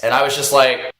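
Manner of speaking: normal talking voice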